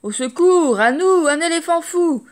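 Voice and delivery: loud, high terrified crowd shout; loud frightened screaming; panicked yell. Acted. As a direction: cry out in panic at the mad elephant. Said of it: "se mettent tous à hurler".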